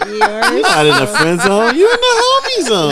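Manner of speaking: loud, high, laughing mock let-down; hammed-up reassurance. Man jokingly rebranding the friend zone, hammed-up reassurance. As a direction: climb to a squeal on the correction.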